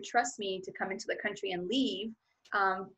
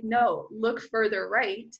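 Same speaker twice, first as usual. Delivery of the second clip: slow, firm inner command; 3 dB louder, much slower than usual. Retold or imitated. Inner guide tells her to look further right, slow and firm. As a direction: let the guide's voice draw it out, calm and sure.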